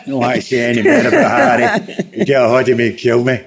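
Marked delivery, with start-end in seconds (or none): 0.1-1.9 laughing through the words, rising high on Harry; chuckling refusal, voice jumping up at the end. Real, not acted. Guest laughing off a question about Harry, laughing. Laughing while declining to continue.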